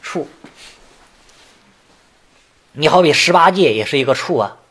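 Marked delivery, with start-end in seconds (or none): 0.0-0.3 处 said chù, sharply falling fourth tone. Shown as a demonstration. Teacher correcting the class on 处, falling chù. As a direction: drop 处 hard from high to low, chù. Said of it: "还是念四声比较好".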